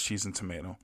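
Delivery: usual speaking voice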